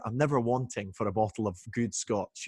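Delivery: usual speaking voice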